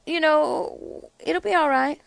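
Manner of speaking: a hint of a soothing, oily reassurance; mock-soothing politician, lightly done; mocking Mitch McConnell. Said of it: "So Mitch McConnell says".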